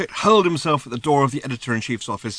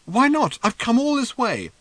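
much higher, pleading protest; indignant Arthur; 12 semitones higher, 4 dB louder than usual. A narrator's reading. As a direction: push back, high and upset.

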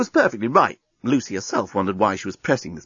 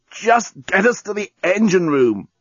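slow, pained gasp; 8 semitones higher than usual. A narrator's reading. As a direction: push it out, short of breath. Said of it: "gasped the journalist".

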